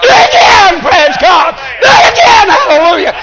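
high, loud revival cry; exultant preaching shout. Acted. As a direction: shout it out as God's joyful command. Preacher voices God calling for praise.

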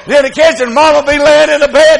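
loud, high-pitched sermon delivery; full-force preaching. Real, not acted. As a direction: preach it hard and loud to the congregation.

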